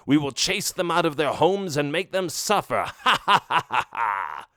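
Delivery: villain cackling with glee at the plan; evil laugh. A narrator's reading. Skeleton chief gloats to Skeletor with an evil laugh.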